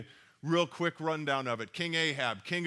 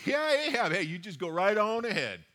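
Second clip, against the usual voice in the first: sarcastic; 3 semitones higher than usual. Retold or imitated. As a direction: say it dripping with sarcasm. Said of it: "his first reaction is sarcastic".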